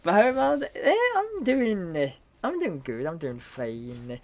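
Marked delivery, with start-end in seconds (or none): 0.0-2.1 high sing-song. Acted. Mock host greeting.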